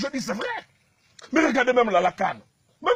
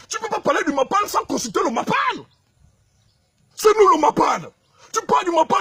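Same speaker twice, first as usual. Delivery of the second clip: angry shouting; 5 semitones higher than usual. Real, not acted. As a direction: shout it at the critics, furious. Angry tirade at critics. (criant de colère)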